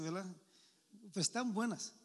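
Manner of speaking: ordinary talk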